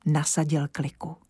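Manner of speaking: plain reading voice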